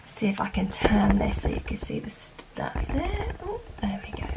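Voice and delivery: soft whisper to self; whispered aside. Real, not acted. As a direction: murmur it under breath. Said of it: "whispering aside while turning book".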